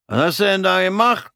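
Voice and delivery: high, sneering soldier's voice; mocking corporal. Bald corporal mocks Fritz's odd uniform, high and sneering. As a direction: needle the newcomer from on high.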